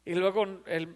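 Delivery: usual speaking voice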